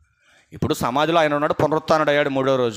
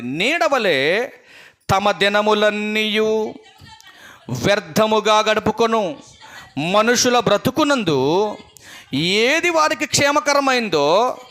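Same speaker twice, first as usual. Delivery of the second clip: very slow, measured reading of the verse; slow scripture reading; 5 semitones higher, slower than usual. Acted. Preacher reads Ecclesiastes to the church, slow and measured. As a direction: let each word of the verse sink in.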